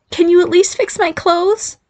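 high, slow squeaky plea; squeaky girl voice. A narrator's reading. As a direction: ask in a squeaky voice. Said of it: "pleading son".

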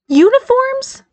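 very high, incredulous echo; skeptical. A narrator's reading. Barry questions his mother's term, skeptical. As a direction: challenge the word in disbelief. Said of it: "Barry challenged skeptically".

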